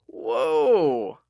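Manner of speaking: put-on loud startled old man's whoa; startled old-timer. Old shopkeeper startled by frantic Lucas, loud whoa. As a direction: jump back with a loud whoa.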